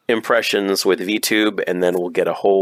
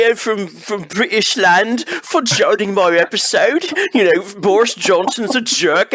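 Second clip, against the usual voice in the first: high-pitched, hammed-up British accent; awful British accent; 10 semitones higher than usual. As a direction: put on a silly, squealing bad British voice. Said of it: "my awful British accent".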